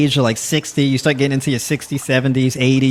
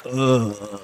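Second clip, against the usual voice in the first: long, trailing "uh"; filler word; 4 dB quieter than usual. Shown as a demonstration. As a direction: stall on a drawn-out "uh". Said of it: "them uhs".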